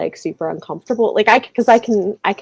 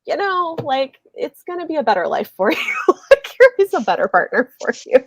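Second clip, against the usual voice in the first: high, light mock reassurance with a laugh; reassuring mock voice; 10 semitones higher than usual. Telling Molly she'll have a better life, high and amused. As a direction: coax her kindly, tongue in cheek. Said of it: "I'm like".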